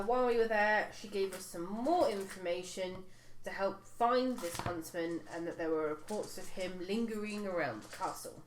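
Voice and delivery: usual speaking voice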